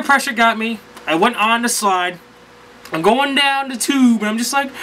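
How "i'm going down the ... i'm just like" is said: ordinary talk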